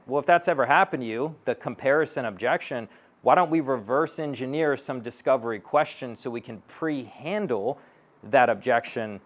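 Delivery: ordinary talk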